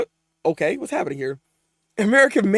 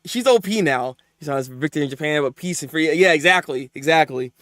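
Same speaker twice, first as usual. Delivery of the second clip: ordinary talk, nothing marked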